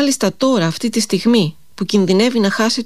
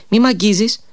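sternly; 2 semitones higher than usual. A narrator's reading. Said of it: "είπε αυστηρά ο άγνωστος".

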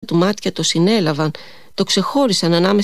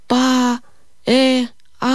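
high drawn-out far-off cry for help; distant desperate cry; 5 semitones higher, much slower than usual. A narrator's reading. A woman lost in the snowstorm cries out desperately. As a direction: call out desperately from far away in the storm. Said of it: "κάτι σαν κραυγή απελπισίας".

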